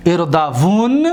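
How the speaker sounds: اردوون ending on a held, vowelless n; still final nun. Shown as a demonstration. Teacher showing students the light nun, اردوون ending in plain n. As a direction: close اردوون on a silent-vowel nun, drawn out. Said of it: "این را ساکن کنید".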